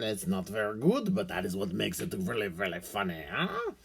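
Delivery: hammed-up French accent, rolling along; lightly put-on French accent for laughs. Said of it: "I like to throw in the French accent".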